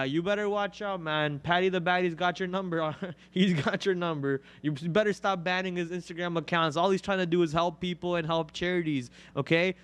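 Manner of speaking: ordinary talk, nothing marked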